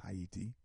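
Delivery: Haiti said "ah-EE-tee", three syllables; native pronunciation. Shown as a demonstration. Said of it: "that's how you pronounce".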